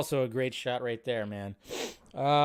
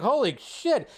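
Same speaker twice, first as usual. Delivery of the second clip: imitated crowd astonishment, high; retold amazement; 7 semitones higher, slower than usual. Party guests learning who is back, high amazed exclamation. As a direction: act out a whole room floored.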